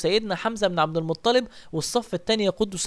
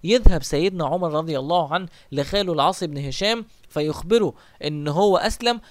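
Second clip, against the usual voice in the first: usual speaking voice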